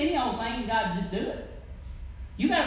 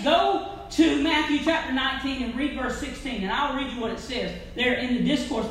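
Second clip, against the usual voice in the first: ordinary talk, nothing marked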